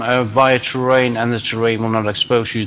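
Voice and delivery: normal talking voice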